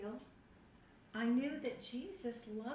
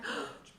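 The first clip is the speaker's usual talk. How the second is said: gasp; 13 semitones higher than usual. Retold or imitated. Discovering the Bible, amazed.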